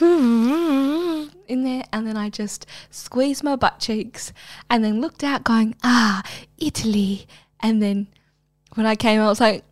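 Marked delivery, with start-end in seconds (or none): 5.8-7.3 breathy whispered sigh of pleasure; dreamy whisper. Pretend. Gazing out the window, pretending to be in Italy, whispered. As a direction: whisper it like you're lost in a daydream.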